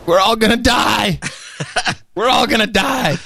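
high, mock doom wail; mock panic. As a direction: wail it in fake terror. Mock panic over news scare.

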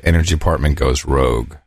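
low, soft title announcement; announcer read. Acted. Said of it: "reads the clip title".

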